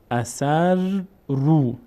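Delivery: each syllable drawn out, pause between; slow syllable-by-syllable reading. Shown as a demonstration. Teacher models exercise words for students, slow syllable-by-syllable reading. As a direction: sound it out slowly for learners to repeat.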